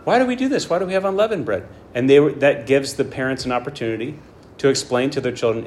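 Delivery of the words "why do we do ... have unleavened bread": put-on high-pitched child asking why; curious kid piping up with questions; child's question. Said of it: "questions in the minds of children".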